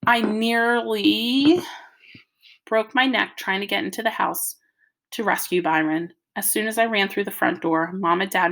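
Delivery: plain reading voice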